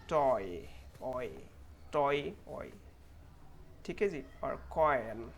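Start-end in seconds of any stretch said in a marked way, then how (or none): 0.1-2.7 "टॉय" with a long, falling oy glide; clear "oy" sound. Shown as a demonstration. Teacher showing the oy in "टॉय", clearly drawn out. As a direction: isolate the oy after "टॉय". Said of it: "ओए sound clearly".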